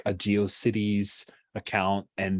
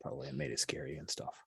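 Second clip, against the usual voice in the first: softly trailing, nervous explanation; 3 semitones lower, 4 dB quieter than usual. Acted. Blue Orchid explains away the scary show, nervously. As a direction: start out hesitant, then shrink into a much quieter voice. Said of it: "Blue, Blue Orchid nervously says".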